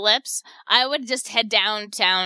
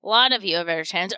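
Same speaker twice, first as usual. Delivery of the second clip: fast, lower throwaway echo; quick repeat; 5 semitones lower, much faster than usual. Shown as a demonstration. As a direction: toss it off at speed. Rushed repeat of own line.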